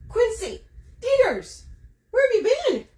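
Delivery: high mock-cheery welcome; high greeting voice. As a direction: greet the mosquitoes like old friends. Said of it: "I'm like".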